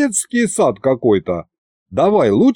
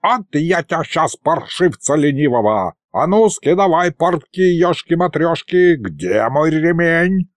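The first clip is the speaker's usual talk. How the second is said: put-on higher-pitched grumpy old wizard, irate; scolding old sorcerer; 5 semitones higher than usual. Old sorcerer threatens lazy pupil with a belt, scolding. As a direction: scold like a cranky little old mage.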